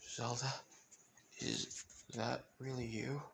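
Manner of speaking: very soft, halting question; hushed disbelief. Acted. Licht looking at his daughter Zelda, hushed disbelief. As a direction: ask it gently, barely daring to believe.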